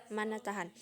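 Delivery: usual speaking voice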